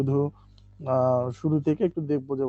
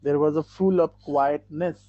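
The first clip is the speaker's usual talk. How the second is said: very slow and louder, words spaced out; slow, louder model reading; 6 dB louder, much slower than usual. Shown as a demonstration. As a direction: read it slowly and clearly to the class. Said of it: "quietness".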